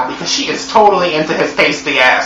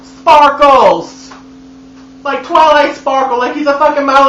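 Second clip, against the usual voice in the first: mock outrage; 7 semitones higher than usual. Real, not acted. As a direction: yell it in outraged disbelief. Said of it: "This motherfucker sparkles".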